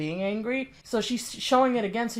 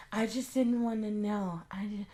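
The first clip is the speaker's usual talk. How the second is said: mocking soft mimic; 2 semitones lower, 7 dB quieter than usual. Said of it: "And now she's saying".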